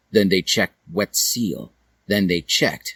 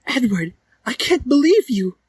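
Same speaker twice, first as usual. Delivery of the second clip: Dally's voice; 3 dB louder than usual. Acted. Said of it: "asked Dally".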